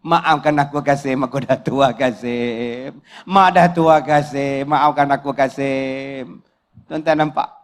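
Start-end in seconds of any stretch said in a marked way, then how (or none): 0.0-6.4 low, drawn-out plea fading on the name; hammed-up remorseful old woman; begging old mother-in-law. Mother-in-law begs Kasim's forgiveness at the grave, mock-pitiful.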